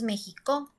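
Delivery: México mis-stressed as "mexicó", final "co" punched; stress on last syllable. Shown as a demonstration. Teacher testing México the wrong way, stress on the last "co". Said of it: "no decimos México".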